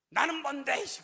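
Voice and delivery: put-on high, loud jealous child's cry; indignant yell. Son protesting to his mother, high indignant yell. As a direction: shout it like a hurt, jealous kid. Son demanding from under blanket.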